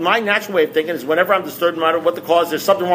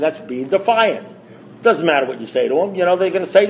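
ordinary talk

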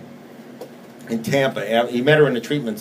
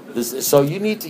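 ordinary talk

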